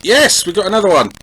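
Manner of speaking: high voice building to a loud celebration; mounting excitement, bursting out on "Yes"; excited. Real, not acted. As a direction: follow the attack with rising hope, then cheer. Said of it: "I don't know why I'm sounding so excited".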